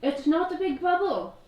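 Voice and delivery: loud call from afar. Acted. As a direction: shout it out across the dark. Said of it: "[name] will call out to you".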